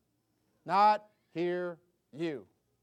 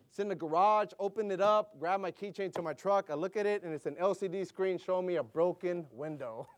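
ordinary talk

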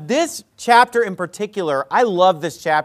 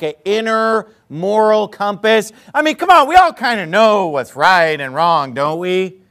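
ordinary delivery

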